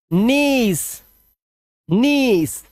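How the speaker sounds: "नीस" drawn out with a long ee; pronunciation demo. Shown as a demonstration. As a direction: stretch the ई in "नीस", teacher-style. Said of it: "IE का उच्चारण क्या करेंगे".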